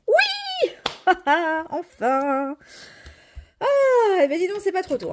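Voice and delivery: thrilled cry of relief at last; excited. Real, not acted. Finally wins after a year.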